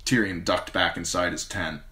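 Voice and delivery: ordinary delivery, nothing marked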